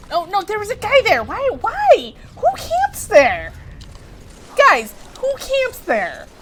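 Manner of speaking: high, loud, exasperated protest; indignant outcry. Real, not acted. Player killed by a camper at the door, indignant outcry. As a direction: wail it high, drawing out the question.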